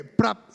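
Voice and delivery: sharp mouth-made hang-up sound; phone slam noise. Retold or imitated. Hanging up on mom: sharp, loud imitated phone slam. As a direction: smack out a quick prap like a phone slammed down.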